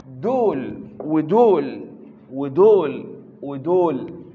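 each دول dragged out slowly and loud; slow emphatic pointing. Real, not acted. Teacher pointing at extended lines on the board, slow and loud. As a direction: lay down دول ودول word by word, hammering each.

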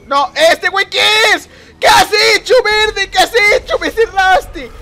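high, loud crying-out protest; real anguished shout; outraged yell. Real, not acted. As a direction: yell at the player who killed you. Killed by rival, yells in protest.

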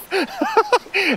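high-pitched giddy laugh; real, breathless high laughter; exhilarated laugh. Real, not acted. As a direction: laugh high from sheer thrill. Thrilled while fighting the fish.

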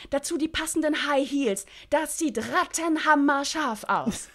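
high, loud, gushing sales pitch; enthusiastic Freddy. Acted. Freddy pitching the mini dress to Lynn, high and gushing. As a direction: sell the dress like it's the best thing ever.

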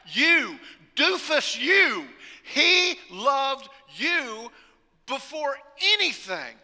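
loud, slow, drawn-out preacher's cry; preaching at full force. Acted. Preacher crying out God's love to the congregation, slow and loud.

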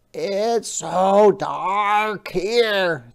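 high, slow, gentle crab voice; a small polite sea creature asking; Hermit Crab voice. Acted. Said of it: "thought Hermit Crab".

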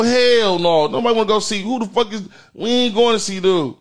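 normal talking voice

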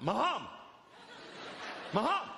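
star-struck, calling out to him; excited shout. Retold or imitated. Fan on the plane calling out to Ali, excited shout.

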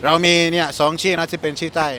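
ordinary talk

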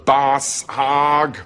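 drawn-out attempt at a southern drawl; southern American accent. Shown as a demonstration. Proving he can do it, laboured southern accent. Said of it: "Can you do a southern American accent?".

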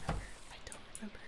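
breathy whisper, barely audible. Real, not acted. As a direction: whisper it to yourself. Repeats the line, then whispers.

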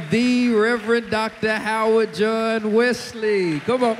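loud, high, drawn-out announcer build; slow ceremonial introduction swelling to a big finish; event host introduction. Real, not acted. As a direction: announce the guest of honor to a crowd.

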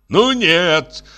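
high, indignant refusal; father's outraged objection; flaring up. A narrator's reading. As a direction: flare up in protest, pitch jumping. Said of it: "взвился опять отец".

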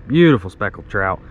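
normal talking voice

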